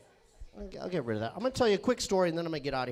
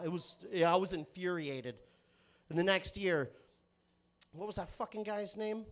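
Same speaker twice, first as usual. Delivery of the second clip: normal talking voice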